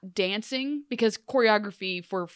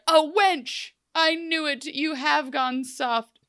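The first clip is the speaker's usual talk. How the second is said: mock-scornful pirate, pitched up; hammed-up pirate reproach, slowing at the end; Blackbeard; 7 semitones higher, slower than usual. Blackbeard scorns Hook, retold.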